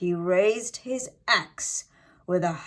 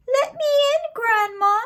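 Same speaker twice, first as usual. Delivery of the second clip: much higher, louder fake little-girl voice; wolf faking a girl; 6 dB louder than usual. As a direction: squeak like a girl to fool Grandma.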